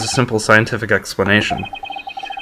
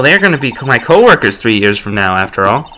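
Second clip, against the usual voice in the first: ordinary talk, nothing marked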